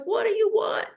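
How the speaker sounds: high-pitched mock movie plea; The Notebook impression. Quoting a movie lover's demand, high and put-on. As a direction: imitate a dramatic film quote, voice pushed way up. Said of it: "what's that movie? The Notebook. She's like".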